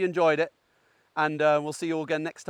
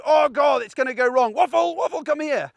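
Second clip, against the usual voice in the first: higher mock panic, calling the dog in a fluster; panicky owner; 7 semitones higher than usual. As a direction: imitate an owner losing their nerve, voice climbing. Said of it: "they go".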